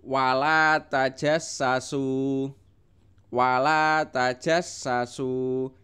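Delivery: wala tajassasu slow, la and su drawn out; long vowels. Shown as a demonstration. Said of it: "Yang panjang adalah la dan su".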